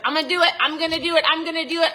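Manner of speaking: lightly mock someone who always promises; mock eager procrastinator. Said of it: "people are like".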